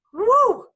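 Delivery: high, loud "woo"; sudden whoop. Acted. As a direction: let out a bright exposed woo. Imitated whoop of baring it all.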